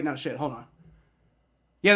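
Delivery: ordinary delivery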